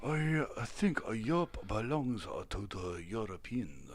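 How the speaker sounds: soft, halting imitation Tibetan accent; mock Tibetan accent. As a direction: quietly put on a caricatured Tibetan accent. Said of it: "my best offensive accent yet".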